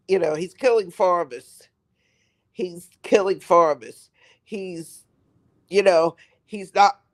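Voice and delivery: ordinary talk, nothing marked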